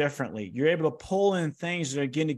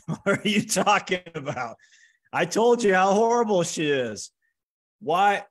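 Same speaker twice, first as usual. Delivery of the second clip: raised, louder, upset protest with a laugh at the start; retelling an argument with the coach, higher and louder; mock indignant protest; 6 semitones higher, 3 dB louder than usual. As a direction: sound betrayed and indignant, pitched up high. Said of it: "So I start arguing with him".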